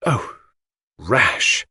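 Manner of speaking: lightly mocking, throwing the word back; a faint scoff at his caution. Mary mocks the narrator's caution, lightly scoffing. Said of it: "Her voice mocked my prudence".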